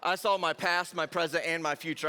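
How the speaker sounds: ordinary talk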